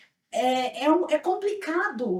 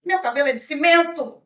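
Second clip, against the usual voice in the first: loud, pointed comeback; retold indignant retort; 3 semitones higher, 6 dB louder than usual. Actress answering the director about her hair, retold indignant retort. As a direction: re-enact an affronted reply, raising the volume. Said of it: "Eu olhei e falei assim".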